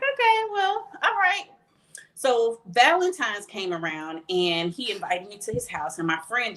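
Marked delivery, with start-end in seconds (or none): 0.0-1.4 impressed okay. Retold or imitated. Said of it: "and I was like".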